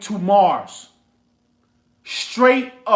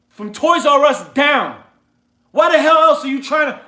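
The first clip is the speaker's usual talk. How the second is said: loud and higher, pushing hard at the crowd; heated yelling rant; 4 semitones higher, 5 dB louder than usual. Real, not acted. Host yelling at his listeners to quit retail. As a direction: preach it at full force, fed up. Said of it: "I ain't trying to yell at y'all".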